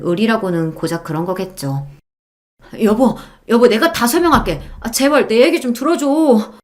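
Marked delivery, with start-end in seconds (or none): 2.8-6.5 higher-pitched, desperate pleading; begging husband. A narrator's reading. Cheating husband begging his wife to listen, pleading. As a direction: plead frantically to be heard. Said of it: "두 손을 싹싹 비벼가며".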